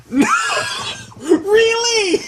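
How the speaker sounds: high, loud cry of disbelief; loud, high squawk of disbelief; exasperated outcry. Real, not acted. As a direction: shriek it in protest at the game. Exasperated reaction to the game.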